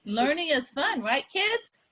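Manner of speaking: put-on high, bright call to the kids; rally the kids, pitch up; teacher cheering kids on about learning.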